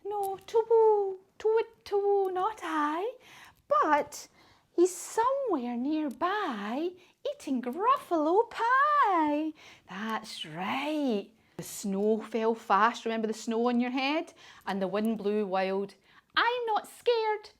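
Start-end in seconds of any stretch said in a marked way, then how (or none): 0.0-9.5 high, slow hooting owl; sing-song owl sending him onward; high owl tells the child where to go. Acted. Owl speaks to the Gruffalo's child. 16.4-17.5 high, slow mock-brave child; hammed-up child claiming courage; brave little Gruffalo.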